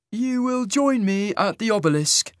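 high, slow, sing-song otherworldly voice; lilting and eerie, drawn out. A narrator's reading. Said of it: "Isaac's sing-song voice".

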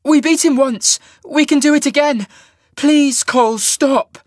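high-pitched, loud begging; desperate pleading. A narrator's reading. Sasha begging her friend Cole to stop, pleading. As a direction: beg him to stop, voice right up high. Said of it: "Sasha pleaded".

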